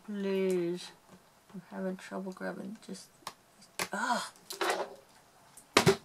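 muffled words around a clip in the mouth; mouth full. Real, not acted. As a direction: talk with an object held in the teeth. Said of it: "Take that out of my mouth".

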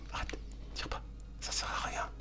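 very soft whisper; whispered self-restraint. Real, not acted. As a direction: whisper it to hold yourself back. Se raisonne tout bas après panique. (chuchoté, pour se retenir)

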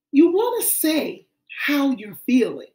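usual speaking voice

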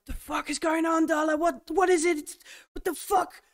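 high, frantic panic, voice cracking up; scared grunt losing it on a dark camera feed; panicked soldier. Acted. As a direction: blurt it out in terror. Farouk panics at the scream.

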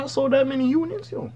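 high, far-off mock drug-dealer brag; mock rapper boast. Mocking rappers who think they're Pablo Escobar, high hammed-up boast. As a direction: ham up a rapper bragging about units.

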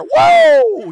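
high, loud wrestler's "Whoa!" yell; mock wrestling-star yell, high and loud; Ric Flair whoo. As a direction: let out a hammed-up Ric Flair holler. Imitating Ric Flair's trademark yell.

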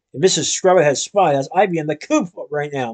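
ordinary delivery

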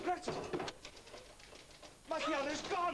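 high, alarmed call; shocked and frantic, pitch soaring; panicked outcry. Acted. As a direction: shout the disaster to a friend. Finds his piano is gone.

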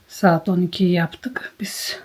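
slow, tired whisper from bed. Real, not acted. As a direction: drag it out in a weary whisper. Tired sick woman in bed. (yorgun, yavaş fısıltı)

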